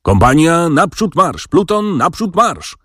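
high-pitched drawn-out marching orders; narrator's restrained command calls; repeated officers' commands. A narrator's reading. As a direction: relay the order like officers down the line. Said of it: "powtórzyli różnymi głosami oficerowie".

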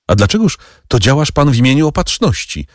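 wyższy, szybki, wzburzony okrzyk; wyrzuć mu to pytanie z oburzeniem; okrzyk Mercedes. Acted. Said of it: "wykrzyknęła Mercedes". (Mercedes wykrzykuje do hrabiego wyżej i szybciej)

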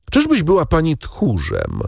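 high-pitched contemptuous challenge; scornful question. A narrator's reading. Husband asking whether his wife is a coward, scornfully. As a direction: taunt with scorn at a normal pace. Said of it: "zawołał Villefort z pogardą".

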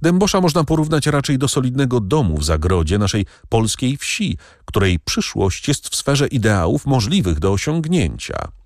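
fast, eager and high; quick, spirited argument; lively. A narrator's reading. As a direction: rush on with eager conviction. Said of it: "zawołała żywo Kasia".

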